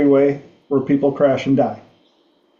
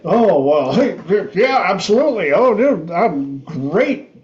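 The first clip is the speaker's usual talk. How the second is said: much higher, laughing, delighted "absolutely"; giddy comedian; 4 semitones higher than usual. Retold or imitated. Struggling comedian accepting a three-night show, overjoyed and giddy. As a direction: gush with delight at the offer. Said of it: "he's like".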